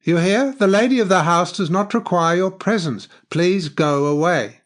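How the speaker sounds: loud, high-pitched order to leave; stern dismissal. A narrator's reading. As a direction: send the unwelcome visitor away forcefully.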